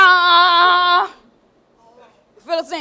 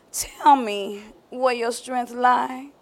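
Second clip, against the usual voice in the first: seductive coaxing; 5 semitones lower, 6 dB quieter, slower than usual. Acted. Delilah coaxing Samson on pillow.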